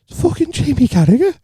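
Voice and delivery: high, excited gasp of recognition; high-pitched disbelief; starstruck realisation. Retold or imitated. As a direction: blurt his name, star-struck. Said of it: "I was like".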